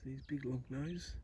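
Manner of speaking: very soft, so as not to startle; hushed animal talk. Real, not acted. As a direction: talk quietly to a shy little creature. Creeping up on the echidna.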